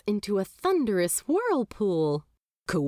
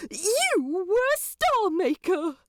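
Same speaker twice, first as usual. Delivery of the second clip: astonished realisation; 12 semitones higher than usual. A narrator's reading. Aziraphale realising the demon made stars, astonished. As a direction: blurt it out in sudden wonder.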